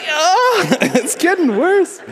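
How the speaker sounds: very high, drawn-out whimper; comic squeal of panic with a laugh; mock dread. Child overhearing angry dad, squeaking in mock dread.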